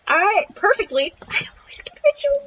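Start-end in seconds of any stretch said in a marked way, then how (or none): 1.2-2.5 high, quick whispered aside. Real, not acted. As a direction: whisper it fast to yourself. Muttered aside.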